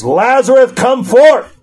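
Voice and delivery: high, ringing call across the tomb; loud cry. Acted. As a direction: shout the dead man out of his grave. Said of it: "he cried with a loud voice".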